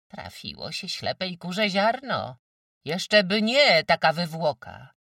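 loud, high-pitched derision; scornful retort. A narrator's reading. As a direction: throw it out with loud contempt. Mother's scornful retort in quarrel. (głośno, z pogardą)

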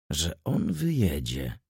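low, soft and slow musing; quiet thinking aloud; as if to himself. A narrator's reading. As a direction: say it inwardly, slowing down. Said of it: "mówił Ochocki jakby do siebie".

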